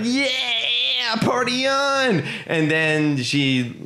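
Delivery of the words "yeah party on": high, slow party cheer; hammed-up cheering orcs. Orcs celebrating the end of battle, high mock cheer. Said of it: "the orcs are like".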